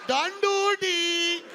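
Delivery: put-on slow, high Indian accent counting. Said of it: "the Indian accent".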